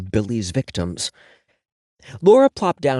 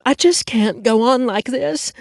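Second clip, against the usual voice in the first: high, soap opera theatrics; melodramatic; 12 semitones higher, 3 dB louder than usual. Acted. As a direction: overplay it like a soap star. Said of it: "daytime soap opera theatrics".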